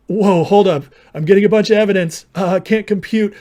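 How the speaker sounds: mocking overwhelmed opponent. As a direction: imitate a debater short-circuiting, loudly. Mimics opponent overwhelmed by evidence.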